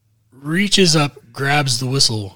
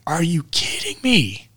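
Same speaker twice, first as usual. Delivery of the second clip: incredulous; 5 semitones higher than usual. Retold or imitated. Incredulous reply in retold story.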